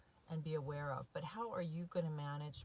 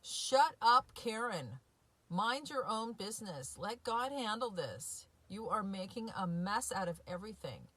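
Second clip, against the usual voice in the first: self-scolding; 4 semitones higher, 6 dB louder than usual. Pretend. Scolding herself in mock self-talk.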